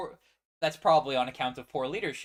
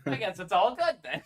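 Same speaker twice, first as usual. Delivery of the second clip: sarcastic fake acceptance; 5 semitones higher, 6 dB louder than usual. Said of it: "Echo doesn't think".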